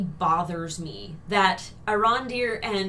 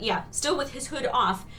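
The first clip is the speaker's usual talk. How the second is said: usual speaking voice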